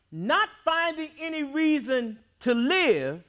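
ordinary delivery

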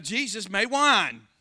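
normal talking voice

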